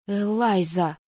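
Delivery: her name hissed like a viper. A narrator's reading. Heroine warning a stubborn friend, hissed name. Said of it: "прошипела я не хуже любой гадюки".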